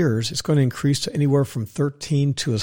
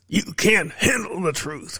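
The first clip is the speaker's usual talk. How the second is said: Jack Nicholson; 4 semitones higher, 3 dB quieter, slower than usual. Retold or imitated. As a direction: lean into a light Nicholson impression, slowly. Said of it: "What does Jack say?".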